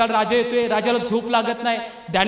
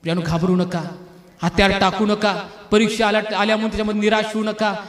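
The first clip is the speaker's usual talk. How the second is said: ordinary talk, nothing marked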